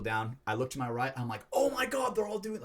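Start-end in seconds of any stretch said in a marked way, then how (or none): 1.5-2.6 retold cry of alarm, voice jumping high; panicked battle cry. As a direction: suddenly pitch up in mock panic. Acts out his alarm in battle.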